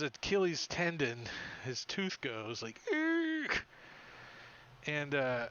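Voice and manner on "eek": tiny high squeak; a sharp little squeak. Acted. Creature biting an ankle, high tiny squeak.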